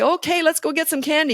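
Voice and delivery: high sugary candy offer; overly sweet treat promise; wrong bribing voice. Shown as a demonstration. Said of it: "You don't say".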